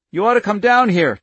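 high, loud furious demand; loud cry. A narrator's reading. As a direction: yell it down at him. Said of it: "Charlie cried loudly".

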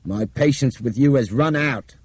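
stern and fed up; grim warning. A narrator's reading. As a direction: warn him forcefully. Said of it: "Nick said grimly".

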